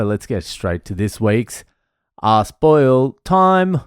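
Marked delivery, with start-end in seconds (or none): 2.2-3.8 segment announcer. Acted.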